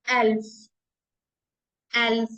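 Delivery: एल्फ said short "elf"; एल्फ repeated clearly; German elf. Shown as a demonstration. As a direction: model एल्फ for learners. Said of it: "eleven".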